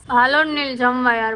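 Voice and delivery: loud call across the room to come eat. Real, not acted. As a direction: shout for Neel to come to lunch. Calling Neel to eat. (મોટેથી બૂમ પાડીને બોલાવવું)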